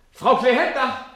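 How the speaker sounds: calling her name across the room; loud call for Frau Klehender. Acted. Calling for the missing woman.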